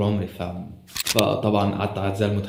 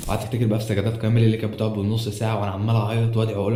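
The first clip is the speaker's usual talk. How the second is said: usual speaking voice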